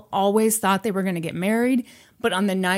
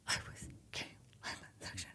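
hushed confession; 25 dB quieter, much faster than usual. Acted. Brooke confessing a secret to Elle, in a whisper.

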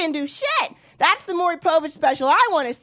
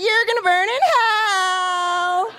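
high, very slow gleeful warning; high drawn-out thrilled delivery; more excited; 5 semitones higher, much slower than usual. Shown as a demonstration. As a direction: relish it like good news. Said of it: "say that a little more excited".

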